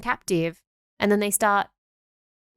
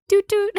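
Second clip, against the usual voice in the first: train whistle toot; 10 semitones higher, 7 dB louder than usual. Pretend. Mimicking a freight train.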